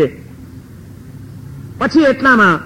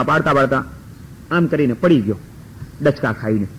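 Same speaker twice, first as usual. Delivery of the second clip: fading away; 7 semitones lower, 6 dB quieter than usual. Acted. Faking collapse, voice fading.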